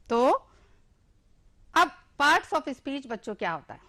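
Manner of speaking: normal talking voice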